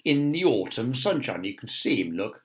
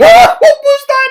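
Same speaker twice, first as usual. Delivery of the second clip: very high, loud frightened shriek; 22 dB louder than usual. Acted. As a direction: shriek at a sudden noise. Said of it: "shrieked Mister Jolly".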